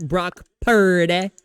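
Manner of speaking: Brock Purdy with a long, drawn-out PUR; exaggerated mock stretch on Purdy; Cam Newton. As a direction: say Brock Purdy slowly, stretching the first syllable of Purdy. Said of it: "he says Brock Purdy's name".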